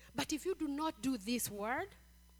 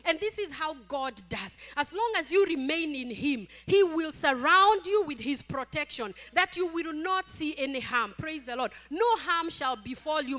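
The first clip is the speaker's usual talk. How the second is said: loud, raised-pitch preacher's assurance; full-force preaching; 4 semitones higher, 8 dB louder than usual. Real, not acted. Preacher assures the congregation of God's protection, forceful preaching.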